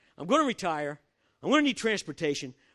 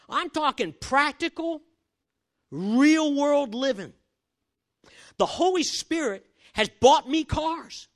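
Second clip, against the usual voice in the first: preaching emphatically; 11 semitones higher, 5 dB louder, slower than usual. Acted. Preaching at full force.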